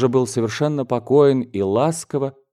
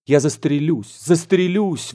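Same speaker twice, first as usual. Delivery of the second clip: high-pitched desperate outburst; hammed-up anguished cry without much volume; wailing; 6 semitones higher than usual. A narrator's reading. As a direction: wail in despair, pitch climbing. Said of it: "вопил дядя".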